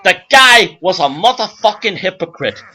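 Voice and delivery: loud, hammed-up club comic working the crowd; brash stand-up comedian. Joey Schmuck playing the Copacabana crowd, brash comic patter. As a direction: belt it out like a hack nightclub act.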